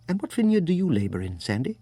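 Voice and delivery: put-on lightly English-accented seatmate, lilting upward; slightly English accent. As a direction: ask briskly in a light California-Brit accent. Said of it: "His accent was hard to place, something English in it".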